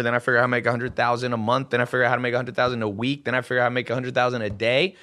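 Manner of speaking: fast, rattling off each step; speeding up. Real, not acted. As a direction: rush through the list, gaining momentum. Listing escalating income milestones.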